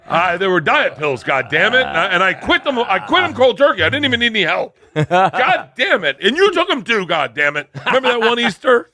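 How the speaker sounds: high, loud mock outrage, insisting; hammed-up indignant yelling; mock indignant rant. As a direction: play the riled-up friend swearing he quit. Mocking friend's denial, ranting.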